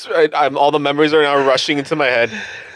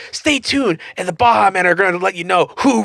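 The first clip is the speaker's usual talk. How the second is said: higher hammed-up TV presenter; showy presenter tease; mock awards host; 4 semitones higher than usual. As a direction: announce it like an over-the-top show host. Acts out awards show host.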